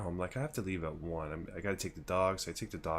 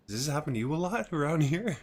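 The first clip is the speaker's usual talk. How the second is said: incredulous; 9 semitones higher, 3 dB louder than usual. Retold or imitated. Friend's quote, high.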